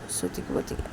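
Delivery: ordinary delivery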